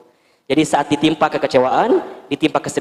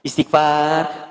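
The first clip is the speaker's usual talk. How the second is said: slow istighfar; 3 semitones lower, much slower than usual. Acted. Wife calming herself, drawn out.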